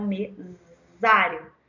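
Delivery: "mesário" slowed, with a buzzing z for the s; stretched Z sound. Shown as a demonstration. Teacher giving the answer, "mesário" with its Z sound drawn out. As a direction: draw out the "z" in "mesário". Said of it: "Qual é que tem som de Z?".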